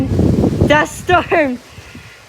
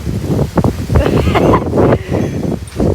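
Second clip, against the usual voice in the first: giddy laugh. Real, not acted. Laughing in the dust storm.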